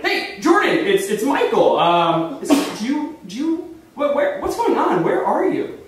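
high, stumbling, insistent questions; urgent questioning. Retold or imitated. Caller finally reaching his missing friend, urgent and flustered. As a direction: pitch it up and trip over the questions.

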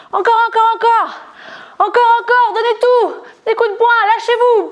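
high, urgent coach cheering the punches on; energetic push. Real, not acted. Fitness coach urging viewers to punch harder, high and pushing. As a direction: drive them to give everything.

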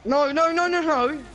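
high loud repeated no; loud protest. Real, not acted. Begging teammate not to abandon, loud. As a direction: shout no to stop him.

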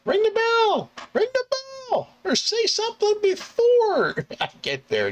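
very high, loud mock rant; exasperated high-pitched shouting; exasperated yelling. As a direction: screech it at the late passenger, hammed-up. Driver yelling back at passenger.

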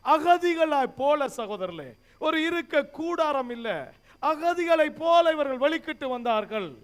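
fervent, insistent pulpit delivery; full-force preaching. Real, not acted. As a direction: proclaim it to the whole congregation.

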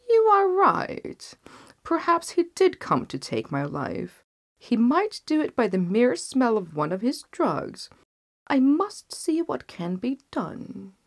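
a weak king talked into fear; anxious king. Acted. King agrees, growing fearful.

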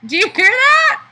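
high, loud thrilled question; excited squeal. Real, not acted. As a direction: squeal it to the viewers. Excited by app sound.

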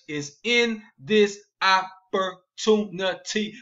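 OPPORTUNITY broken into slow, separate syllables; slow, punched "this opportunity", one beat per syllable; emphatic syllable by syllable. Real, not acted. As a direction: hammer out each syllable of "opportunity", slowly. Hammers the key selling word.

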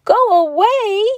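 high, loud shocked question; shocked cry. A narrator's reading. Bear cub hearing his friend must leave, shocked. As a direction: cry it out in disbelief. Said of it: "cried Little Bear, shocked".

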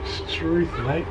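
put-on Australian accent. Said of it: "quite good at Australian accents".

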